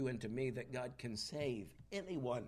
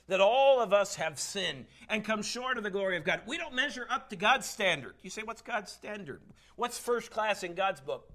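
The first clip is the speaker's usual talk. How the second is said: loud, raised preacher's voice; forceful preaching; 6 semitones higher, 8 dB louder than usual. Real, not acted. Preacher tells church all have sinned, forceful. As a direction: drive it home to the whole congregation.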